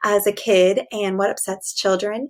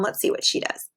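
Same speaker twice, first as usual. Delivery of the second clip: usual speaking voice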